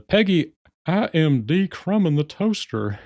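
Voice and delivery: high, slow, drawn-out character voice; put-on high-pitched sitcom dad voice; Hank Hill. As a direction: imitate a cartoon dad, stretching out every word. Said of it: "that freaking Hank Hill voice".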